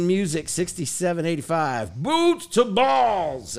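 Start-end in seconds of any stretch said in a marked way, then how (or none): 2.0-3.6 high, drawn-out hype call; stretched, raised-pitch show-name cheer; emphatic exclamation. Acted. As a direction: read it with a big exclamation point. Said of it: "Exclamation point".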